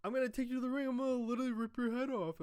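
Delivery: high, mock-jolly threat; fake-amused taunt; sarcastic laughing threat. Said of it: "all sarcastically laughing".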